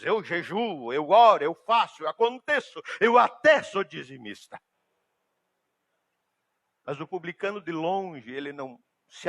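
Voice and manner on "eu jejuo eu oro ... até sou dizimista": a hint of a self-proclaiming Pharisee, louder; lightly acted boasting, listing virtues emphatically; boastful Pharisee. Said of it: "proclamar as suas virtudes". (fariseu se gabando, levemente encenado)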